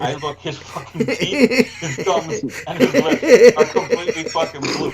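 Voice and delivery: high, loud, breaking into laughter mid-sentence; can barely get the words out for laughing; laughing hard. Real, not acted. Telling friends about dad's blue teeth, laughing hard.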